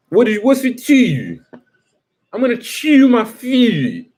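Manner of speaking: high, stretched-vowel mock Baltimore accent. Comic mimicking how Baltimore locals talk, high Baltimore accent. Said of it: "'Cause they be talking like".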